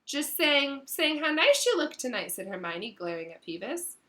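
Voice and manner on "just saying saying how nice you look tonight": falsely bright. Acted. Hermione covering, falsely bright.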